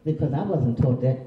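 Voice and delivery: ordinary talk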